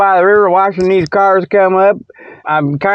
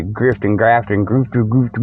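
low, quieter teasing that crumbles into nonsense; playful muttered teasing; 9 semitones lower, 4 dB quieter than usual. Pretend. Host ribbing a listener as a grifter, playful low muttering. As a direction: mumble the words into a gibberish tumble. Mock-scolding a listener, garbling into laughter.

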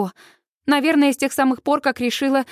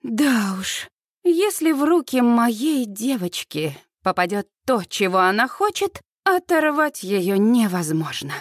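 ordinary delivery

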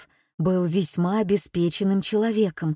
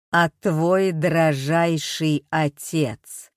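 very slow, louder, lower machine voice; metallic robot voice; 3 semitones lower, 3 dB louder, slower than usual. A narrator's reading. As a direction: dictate terms through a voice changer. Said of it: "механический голос".

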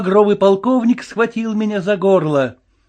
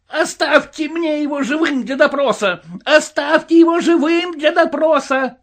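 high, urgent shouted plea; frantic yelling; 11 semitones higher than usual. A narrator's reading. Officer pushing through a mob for his prisoner, yelling. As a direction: call over a crowd in a high voice. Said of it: "вопил Подтяжкин".

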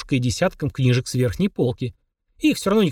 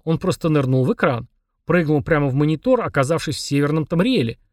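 usual speaking voice

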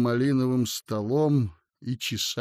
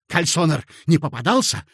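curt question; 4 semitones higher, 6 dB louder than usual. Acted. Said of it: "отрывисто спросил Коротков".

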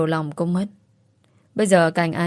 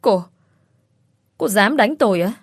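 shrieking outrage; 3 semitones higher than usual. A narrator's reading. Rival shrieks at the woman who slapped her, outraged. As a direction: spit it out, stunned she was slapped. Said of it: "cô ta liền rít lên".

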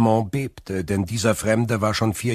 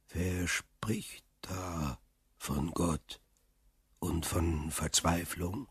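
much softer, slow, distant muffled voice; voice from under the ground; 2 semitones lower, 10 dB quieter, slower than usual. A narrator's reading. Hidden prisoner calls up through the earth, faint and distant. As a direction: sound as if rising up through stone. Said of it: "die unter der Erde heraufzukommen schien".

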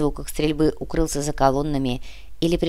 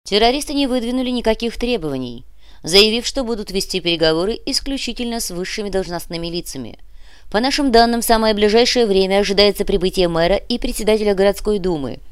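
raised, driving newsreader delivery; radio news report; 4 semitones higher, 3 dB louder than usual. Acted. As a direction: report a crisis live on air.

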